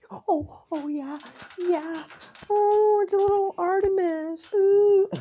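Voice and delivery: very high, sing-song squeal. Real, not acted. Cooing at little Artemis.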